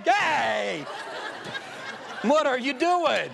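mock scolding. Acts calling out couple during church.

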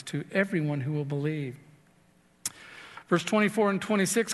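normal talking voice

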